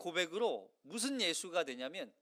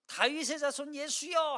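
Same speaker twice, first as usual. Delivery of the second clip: crying out; 7 semitones higher, 3 dB louder than usual. Acted. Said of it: "하니까 꾸짖어요".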